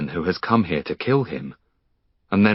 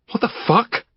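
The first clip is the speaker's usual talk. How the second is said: much higher startled cry; shocked. Acted. Finn shoved by a stranger, shocked. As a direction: caught off guard by an intruder.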